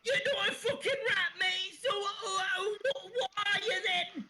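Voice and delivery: high, whiny Cockney rat in a flap; squeaky Cockney rat voice; panicky Cockney rat. Acted. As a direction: wail like a fretting street rat. Rat voice answers.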